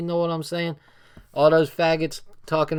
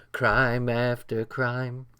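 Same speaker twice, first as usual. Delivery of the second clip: slow mock voice; 3 semitones lower, much slower than usual. Shown as a demonstration. Repeats phrase in two voices.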